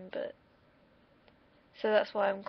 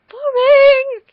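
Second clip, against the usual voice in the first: Boring! yelled much higher and louder in a put-on voice; mock yell; 23 dB louder than usual. Vlogger ridiculing a plain exam report, put-on mock yell. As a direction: belt out Boring! high and loud in a silly voice. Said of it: "'cause that's boring".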